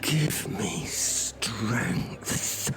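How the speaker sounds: slow whispered sigh of exasperation; despairing whisper. Acted. Host whispering despair at Albert's rambling. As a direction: breathe it out, at the end of your rope.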